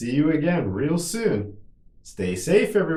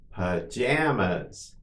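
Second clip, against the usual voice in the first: drawn-out word for learners; slow model; 2 semitones lower, slower than usual. Shown as a demonstration. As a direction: say it slowly and evenly for repetition. Teacher models word for repetition.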